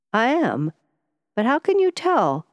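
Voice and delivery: high, rising cry of astonishment; startled disbelief. A narrator's reading. Harland astonished the stranger knows his pain, high startled question. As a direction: jump up in pitch, caught off guard.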